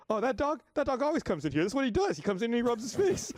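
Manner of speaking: high, breezy bartender voice; a casual bartender who's seen it all before; chirpy bartender. Retold or imitated. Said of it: "and she's like".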